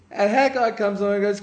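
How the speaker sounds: ordinary talk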